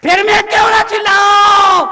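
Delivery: high, full-force shouted question; shouting. Real, not acted. Monk justifying his loud preaching to critics, shouting. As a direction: bellow it as a defiant preacher. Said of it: "चिल्ला चिल्ला के कहता हूं".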